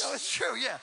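high, bursting laugh-shout of protest; excited insistence. Real, not acted. Answering the heckler about his pay, excited high protest. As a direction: shoot up and insist it really happened. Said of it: "Mine doesn't even pay that much".

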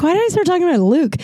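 fast, high, puzzled aside; baffled self-question. Retold or imitated. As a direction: rush it out, bewildered. Self-quote, wondering aloud.

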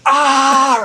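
loud, high mock scream; full-volume imitated scream of exasperation; retold yell back. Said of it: "looked right back at him and went".

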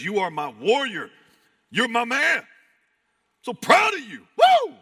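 loud, high, whooping pride; elated. Acted. God calling you to rise in the morning, elated. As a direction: cheer like a proud father, building to a whoop. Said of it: "there's a God that is elated".

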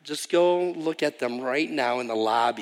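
usual speaking voice

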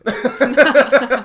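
high, loud laugh through the words. Real, not acted. Admitting to friends he never learned German, laughing. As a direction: laugh at your own admission. Laughing at his own story.

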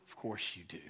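dropped low and soft, almost to himself; quiet aside. Acted. Pastor to parents, a soft low reassurance. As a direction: murmur the answer like a private certainty. Pastor answers his own question.

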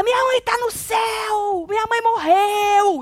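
high, long drawn-out wail; imitated hysterical scream. Retelling the woman screaming about her mother, imitated hysterical scream. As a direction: wail it out as if in a total meltdown. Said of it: "E essa mulher gritava".

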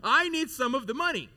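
high, loud, pleading sibling voice; whiny brother. Retold or imitated. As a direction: whine like a greedy younger brother. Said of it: "I could just imagine".

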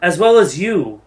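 measured, weighty divine address; stately speech with deliberate pauses; solemn goddess. Acted. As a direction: pronounce it like a goddess setting a hard choice. Game master voices goddess Sehanine addressing group.

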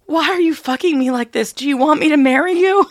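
high, loud, breathless question; excited girl. Retold or imitated. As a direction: blurt it out in a rush. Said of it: "A girl to a guy".

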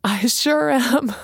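slow words dissolving into high laughter; laughing. A narrator's reading. Messenger admitting she's crazy, laughing. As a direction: give in to the giggles. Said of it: "burst out in another round".

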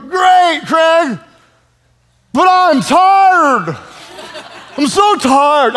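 high, slow, whiny exhausted complaint; tired churchgoer. Retold or imitated. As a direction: moan it like a worn-out listener. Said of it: "some of you are like".

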